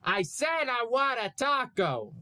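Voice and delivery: high shouted reply; yelled. Acted. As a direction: yell back over deafness. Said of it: "Dipper yelled back".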